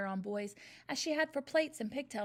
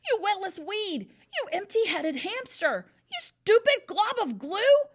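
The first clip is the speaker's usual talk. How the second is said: high, much louder, slow roar; bellowing insults; 10 semitones higher, 11 dB louder than usual. A narrator's reading. Headmistress raging at the boy, bellowing insults. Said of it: "the Trunchbull bellowed".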